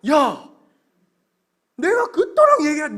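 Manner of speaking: loud exclamation of outrage; shocked shout. Acted. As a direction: burst out like a stunned, hurt parent. God shocked at the complaint.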